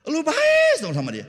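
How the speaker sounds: high, loud, outraged neighbour voice; a hammed-up indignant cry; mock outraged shout. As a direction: shriek it out in mock disbelief. Acted quote continues.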